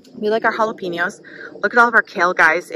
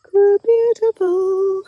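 high, drawn-out, admiring; a long high sigh of admiration; 11 semitones higher, much slower than usual. Real, not acted. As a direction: stretch it out high in awe. Admiring the tomatoes.

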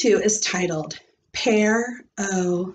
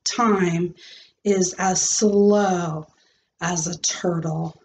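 slow, drawn out; 3 semitones lower, slower than usual. Acted. Teacher reading to class, drawn out slow. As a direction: stretch each word like a crawling turtle.

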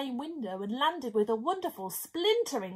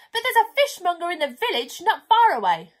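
loud raised call; 4 semitones higher, 9 dB louder than usual. Acted. Narrator calls up to the pelican about the fishmonger, loud. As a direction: keep calling up to the window.

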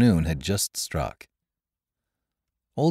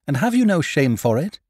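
put-on higher, louder accusing girl, YOU lifted; hot demand; 9 semitones higher, 5 dB louder than usual. The girl confronts the pirate captain, hotly demanding. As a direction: fling the question at him in heat. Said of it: "demanded the girl hotly".

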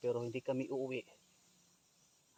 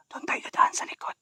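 whispering while hiding; 8 dB louder than usual. Real, not acted. Sneaking round the back.

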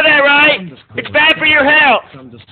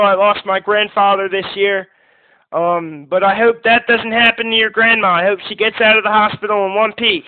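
lower, softer and fast, consoling; gentle sympathy; 8 semitones lower, 3 dB quieter than usual. Real, not acted. Host consoles a caller whose grandma is in hospital, softly. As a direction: comfort the caller softly about his grandma.

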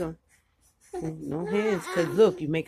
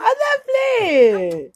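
laughing; 12 semitones higher, 8 dB louder, slower than usual. Real, not acted. Laughing while speaking.

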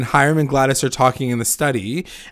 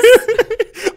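high laugh; 6 dB louder than usual. Real, not acted. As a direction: burst of high-pitched laughter. Laughing at own Archie quote.

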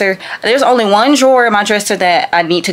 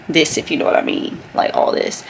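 whispering; 3 semitones lower, 4 dB quieter than usual. Real, not acted. Aside while tidying the room.

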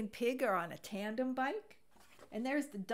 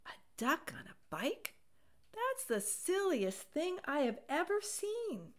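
a touch higher, incredulous; Cow's amused thought; 7 semitones higher than usual. A narrator's reading. Cow thinking about Duck with mild disbelief. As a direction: scoff at the silly sight. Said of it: "what she thought was".

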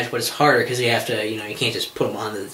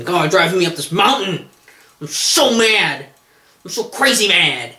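higher mock furious hiker; hammed-up mock rage; 5 semitones higher than usual. Mock angry hiker quote.

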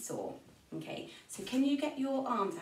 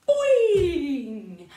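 big loud springy boing; 5 semitones higher, 12 dB louder than usual. Shown as a demonstration. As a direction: bounce the sound. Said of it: "Jump like a frog".

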